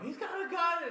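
high, loud startled cry; panicked yelp. Retold or imitated. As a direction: yelp it out in alarm. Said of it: "when Breezy and I go".

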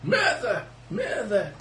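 mock alarm cry. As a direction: play at raising the alarm in a high voice. Mock cry of murder at table.

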